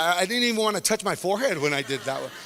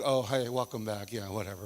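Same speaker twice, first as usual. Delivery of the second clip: lower, softer mock welcome; a smug fake-friendly welcome back; condescending; 8 semitones lower, 7 dB quieter than usual. Catholics smugly welcoming back a returning churchgoer, condescending. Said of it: "that condescending look".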